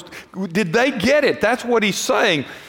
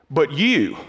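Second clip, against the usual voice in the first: "you" set apart, low and pointed; lower, deliberate stress on "you"; emphatic, personal; 4 semitones lower than usual. Shown as a demonstration. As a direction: aim "you" straight at the listener. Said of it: "it becomes very personal and says".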